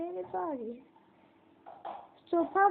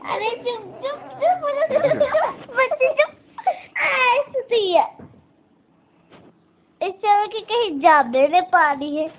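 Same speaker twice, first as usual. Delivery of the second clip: loud hushing; 7 semitones higher, 13 dB louder than usual. Real, not acted. Calling loudly to hush the crying little girl, loud.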